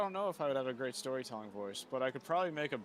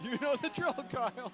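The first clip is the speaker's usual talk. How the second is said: hyped-up call; 12 semitones higher, 4 dB louder than usual. Acted.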